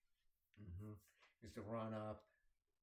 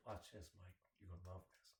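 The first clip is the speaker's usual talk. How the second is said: softer, lower, conspiratorial; sly hushed tease; 4 semitones lower, 9 dB quieter than usual. Retold or imitated. Sean tipping Mike off before his prank, hushed and sly. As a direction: lean in and tip him off quietly. Friend slyly setting up prank.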